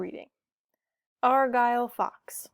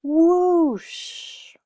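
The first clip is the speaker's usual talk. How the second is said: loud whooshing wind; 8 semitones higher, 7 dB louder than usual. A narrator's reading. Wind strikes the pirate game.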